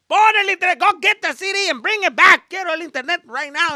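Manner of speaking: high, loud commanding yell; dad barking orders. Retold or imitated. Dad demanding the internet back, loud commanding yell. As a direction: order the kid around like an angry father.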